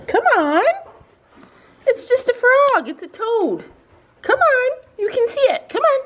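high coaxing pet voice. Real, not acted. Owner coaxing scared dogs to the frog, high pitched. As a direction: coax the dogs to come look.

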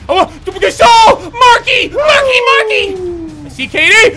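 loud high laughing screams; ecstatic yelling. Real, not acted. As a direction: cry out in wild joy. Frantic cheering, laughing.

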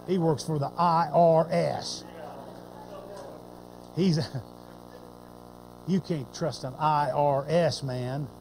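low, quiet sly gossip; a disciple quietly smearing the tax collector. Pretend. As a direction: mutter the rumour sideways. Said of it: "nobody sitting there going".

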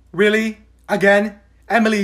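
ordinary talk

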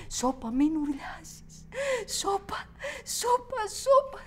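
screaming hush inside her head; building scream. Acted. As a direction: hush that grows into a shriek. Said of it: "Μόνο ουρλιάζω".

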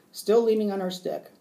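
usual speaking voice

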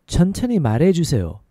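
syllables linked in one smooth run. Shown as a demonstration. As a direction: say it at conversational speed. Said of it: "A little faster".

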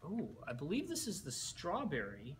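ordinary delivery

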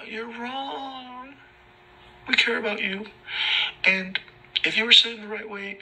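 very high shouted squeaky cop; mocking cop voice. Mocking officers who make him face the wall, very high squeaky voice.

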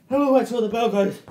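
shouted push to the end; loud urging. Real, not acted. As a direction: drive them to the bell. Coach urging to the bell.

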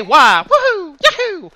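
hammed-up Mario jump cries; imitating Mario. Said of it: "Yet Mario can't say".

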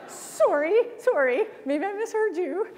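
mock-polite Canadian argument; overly polite Canadian protest. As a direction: argue while apologizing, the polite Canadian way. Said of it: "So I said".

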